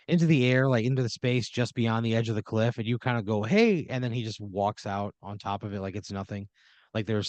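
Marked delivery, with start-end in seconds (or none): 3.4-3.9 calling out. Pretend. Calling out to the angel.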